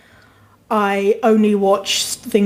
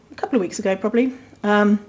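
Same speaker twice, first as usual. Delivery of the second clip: usual speaking voice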